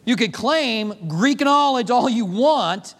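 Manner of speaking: normal talking voice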